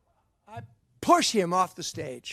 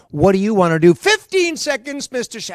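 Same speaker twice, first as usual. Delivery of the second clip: mock pressing countdown; 3 semitones higher, 12 dB louder than usual. Retold audition deadline.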